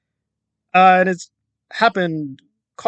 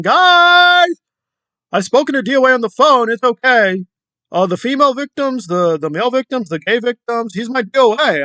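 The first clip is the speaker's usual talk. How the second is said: high, gushing mock voice, a little louder; mocking Nora; 6 semitones higher, 4 dB louder than usual. Commentator mimicking Nora reassuring her audience, high mocking gush. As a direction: sneer it out as a fawning fake ally.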